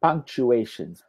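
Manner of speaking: "Punctuations" slowly, every syllable through "u-A-tions"; modelled pronunciation. Shown as a demonstration. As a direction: spell out the sounds of "Punctuations" slowly for a learner. Teacher models a corrected word.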